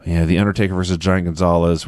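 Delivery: ordinary talk, nothing marked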